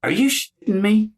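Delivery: high, disbelieving cry; incredulous. Retold or imitated. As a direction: ask it in sheer disbelief. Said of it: "He went".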